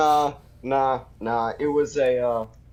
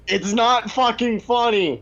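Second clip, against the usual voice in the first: annoyed; 6 semitones higher than usual. Real, not acted. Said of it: "you know why they call it the funny bone".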